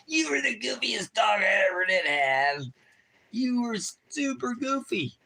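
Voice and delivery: sing-song squeal at the dog; baby talk. Acted. High doggy talk shout.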